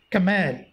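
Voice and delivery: camel as kuh-MEL, second syllable stressed; wrong stress on second syllable. Shown as a demonstration. Teacher showing students the mistake, camel stressed on -MEL. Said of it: "that is wrong one".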